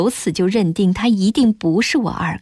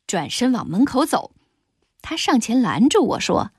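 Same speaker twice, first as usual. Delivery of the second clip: ordinary delivery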